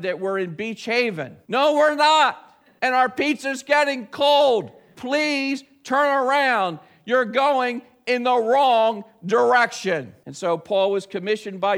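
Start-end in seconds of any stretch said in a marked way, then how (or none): 1.5-10.1 loud, high, drawn-out plea to a lost driver; exasperated shout, high and slow; exasperated calling out. Acted. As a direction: call out slowly and loudly, stretching each word. Acts out calling to lost pizza driver.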